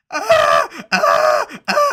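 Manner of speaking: loud, high fake wailing; mock pain screams. Streamer mocking a player carried off, screaming in pain. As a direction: scream like an overacting casualty.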